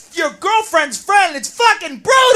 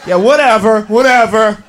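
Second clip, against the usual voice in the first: low, grumbling brush-off; sulky low-pitched boyfriend; dismissive boyfriend; 8 semitones lower, 3 dB louder than usual. Acted. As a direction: brush your girlfriend off.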